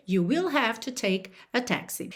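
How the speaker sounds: normal talking voice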